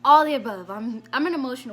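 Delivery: exclaiming all the above with a sudden loud burst. Real, not acted. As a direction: throw the words out loud. Emotional outburst in retelling.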